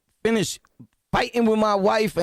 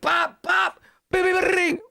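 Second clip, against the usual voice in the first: much higher bursts of hit sounds; mock punch noises; 10 semitones higher than usual. Telling viewers how he hits back, high punch sounds. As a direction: fire off the blows as noises. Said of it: "I'm like".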